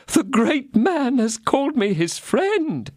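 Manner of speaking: high, slow, awestruck little mercer voice; excited mercer. A narrator's reading. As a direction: gasp it out like a dazzled shopkeeper. Bonacieux voiced.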